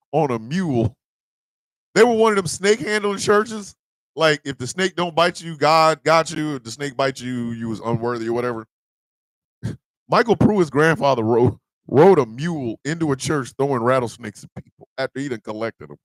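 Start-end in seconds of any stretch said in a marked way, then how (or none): none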